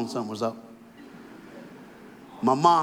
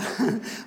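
breathless laugh; 9 semitones higher, 3 dB quieter than usual. Real, not acted. Laughing at his own impression.

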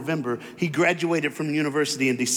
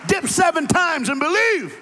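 higher, louder, shouting preacher climax; full-force preaching; 10 semitones higher, 5 dB louder than usual. Acted. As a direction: build it up into a roaring altar call.

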